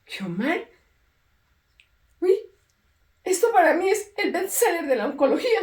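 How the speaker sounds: doctor raving about the book; excited praise. Retold or imitated. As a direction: gush over the manuscript as a hit. Doctor raves about her book.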